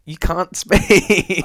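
laughing while teasing; teasing through a laugh. Real, not acted.

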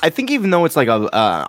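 ordinary talk

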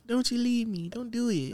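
high, soft pleading; put-on girlfriend voice. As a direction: beg in a high little voice. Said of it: "I'm gonna stop doing the voice".

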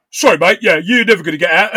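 loud, mock London accent; brisk London-accented taunt with a laugh behind it. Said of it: "My London accent".

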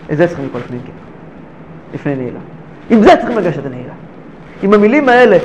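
ordinary delivery

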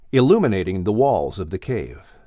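usual speaking voice

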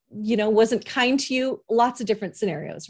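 normal talking voice

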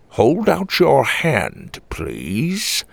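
put-on slow, commanding old man; stern grandpa. A narrator's reading. Grandfather to the nervous woman, slow and commanding. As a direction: draw it out like a wary elder.

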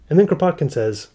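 usual speaking voice

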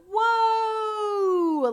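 long, high, loud whoa; acted amazement. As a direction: draw it out in mock astonishment. Storyteller reacts to client's revelation.

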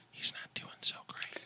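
hushed whispered aside. Real, not acted. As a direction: whisper so he won't hear. Whispered aside about Dick Goddard.

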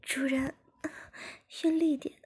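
high-pitched moaning plea from a character; voiced as a moaning character. A narrator's reading. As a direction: plead through moans, pitched well up. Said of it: "小柔边呻吟边说".

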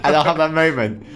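laughing while talking; giggling through the words. Real, not acted.

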